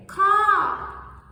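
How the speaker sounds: "ka" very high and loud, falling. Shown as a demonstration. Teacher showing group three: high "ka". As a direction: snap "ka" high. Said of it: "group three, they're gonna say".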